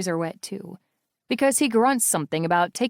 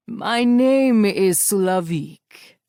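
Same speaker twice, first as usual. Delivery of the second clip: chuckling villain; 5 dB louder, much slower than usual. A narrator's reading. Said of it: "with a little chuckle".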